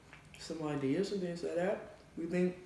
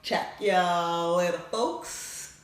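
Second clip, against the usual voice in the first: loud; 6 dB louder, much slower than usual. Real, not acted. Sign-off.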